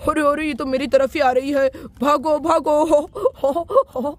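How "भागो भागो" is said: slow, drawn-out cry to run; half-laughing alarm; mock panic. Raj fleeing the runaway car in mock panic. Car heading straight at him.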